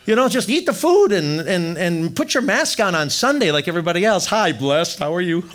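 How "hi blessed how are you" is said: sugary mock-pious hello; mock churchy greeting. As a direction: fake sweet church greeting, laughing. Mocking churchgoers' Sunday mask.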